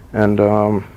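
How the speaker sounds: ordinary talk, nothing marked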